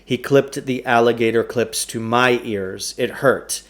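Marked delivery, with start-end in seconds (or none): none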